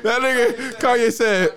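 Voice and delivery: high-pitched words squeezed through real laughter; breathless laughing. Real, not acted. Reading the rapper's comeback to friends, breathless with laughter. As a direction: struggle to talk while cracking up. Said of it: "He's gotta catch his breath".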